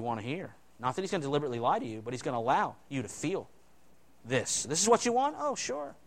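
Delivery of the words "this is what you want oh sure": sarcastic. Acted. Voicing God mockingly.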